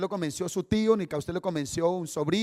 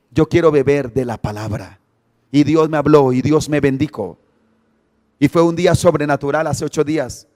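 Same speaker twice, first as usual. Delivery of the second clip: loud, driving pulpit testimony; fiery preaching; 2 semitones lower, 7 dB louder than usual. Acted. Pastor preaching his testimony loudly to the church. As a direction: preach it out full force to the congregation.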